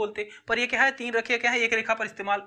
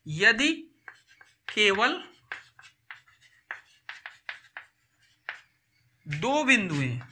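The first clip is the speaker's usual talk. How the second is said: word by word with long pauses; halting write-along delivery, phrase by phrase; dictating; slower than usual. Real, not acted. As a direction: dictate slowly while writing it out. Dictating answer while writing it out.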